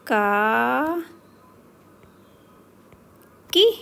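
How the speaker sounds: each syllable ka, kaa, ki, kee said loud and separately with long pauses; slow clear syllable drill. Shown as a demonstration. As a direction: spell out ka-kaa-ki-kee one by one like a teacher. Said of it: "इनका उच्चारण देखेंगे".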